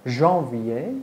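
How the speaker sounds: ordinary delivery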